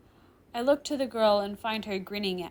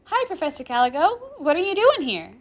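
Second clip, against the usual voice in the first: put-on high, loud, cheery girl; cheerful; 9 semitones higher, 11 dB louder than usual. Girl surprised to meet her professor, cheerfully. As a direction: chirp a cheerful hello, pitched up. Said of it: "Rimuru asks cheerfully".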